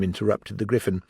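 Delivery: usual speaking voice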